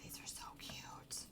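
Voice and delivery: hushed whisper over kittens; soft awed whisper. Real, not acted. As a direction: breathe it, barely audible.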